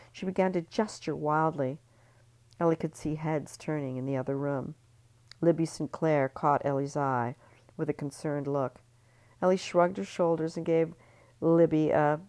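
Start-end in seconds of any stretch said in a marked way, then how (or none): none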